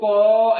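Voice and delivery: क with its 'a' held long and loud; full inherent vowel. Shown as a demonstration. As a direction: say क as a full, drawn-out syllable. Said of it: "ऐसे बोल सकते हैं".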